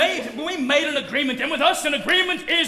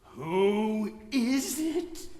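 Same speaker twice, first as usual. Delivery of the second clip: very slow, soft question; low, painfully slow and controlled; strained patience; 4 semitones lower, 8 dB quieter, much slower than usual. Acted. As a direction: draw it out with forced restraint. Father forcing himself to stay calm.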